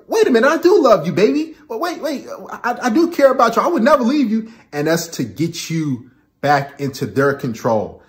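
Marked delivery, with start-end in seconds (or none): 0.1-4.5 high, fast, anxious pleading; mock pleading boyfriend. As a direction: rush to reassure her, pitched up. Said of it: "And you're like".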